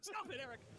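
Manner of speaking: high squeaky stop it, Eric; mock scold. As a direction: play-act a shocked prude, voice up high.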